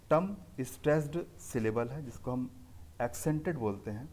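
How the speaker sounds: ordinary talk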